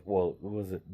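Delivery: ordinary delivery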